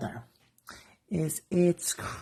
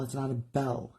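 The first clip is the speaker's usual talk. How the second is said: ordinary talk, nothing marked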